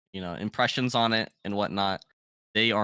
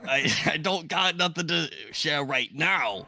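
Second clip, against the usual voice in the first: lazy skull voice; 4 semitones higher than usual. Acted.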